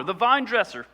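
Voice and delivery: normal talking voice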